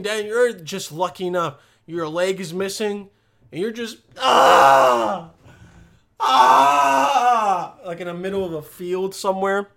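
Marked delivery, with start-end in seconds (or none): 4.2-5.3 long, loud acted scream; crash survivor's scream. Acting lone crash survivor screaming. 6.2-7.7 long, high, loud wail; crash survivor's scream. Acted. Acting lone crash survivor screaming.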